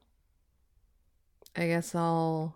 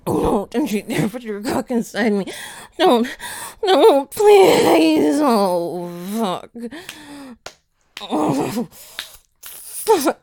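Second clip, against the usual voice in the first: crying shout; 2 semitones higher, 8 dB louder, slower than usual. Acted.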